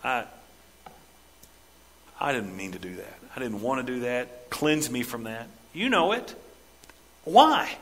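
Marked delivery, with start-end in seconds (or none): 0.0-5.5 low, soft repentant prayer; hushed confession. Acted. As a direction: murmur it like a broken apology. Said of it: "run to say".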